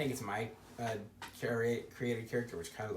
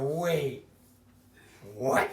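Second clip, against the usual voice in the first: retold baffled reaction; 2 semitones higher, 7 dB louder than usual. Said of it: "I said".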